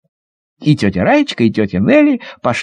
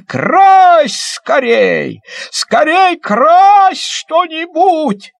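higher, slow, stretched excited call; excited shout; 13 semitones higher, much slower than usual. Acted. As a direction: shout it with delight. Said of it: "как закричит". (восторженно выкрикивая)

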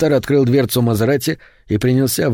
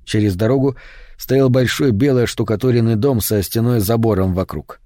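ordinary delivery, nothing marked

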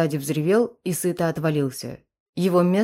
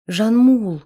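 high, louder moaning call; moaned; 4 semitones higher, 6 dB louder than usual. A narrator's reading. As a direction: moan his name in pain. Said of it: "простонала женщина".

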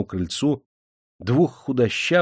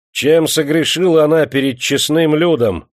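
loud, higher chieftain's question; raised voice; 5 semitones higher, 7 dB louder than usual. A narrator's reading. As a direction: lift the voice over a crowd. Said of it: "возвысил голос атаман". (возвысив голос)

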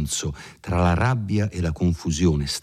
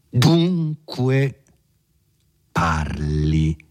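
forceful; much slower than usual. A narrator's reading. Renzo forcing the priest to talk, forcefully. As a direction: drive the word home with force. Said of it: "fu proferito con una tale energia".

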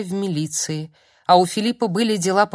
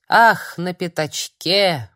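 «на пятачке!» медленно протянуто; растянуть слова с облегчением; протяжно; 4 dB louder, slower than usual. Acted. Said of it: "протянул он". (старик протянул с облегчением)

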